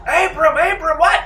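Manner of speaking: high, loud alarmed yell for a fallen comrade; yelling a name. Acted. A bandit cries out over his fallen cousin, loud and shocked. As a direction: shout his name in panic. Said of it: "one of the guys yells".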